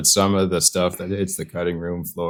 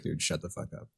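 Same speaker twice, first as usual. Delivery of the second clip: muttered to self; 2 semitones lower, 16 dB quieter than usual. Retold or imitated. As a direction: mutter it under your breath at yourself. Telling self off while listening back.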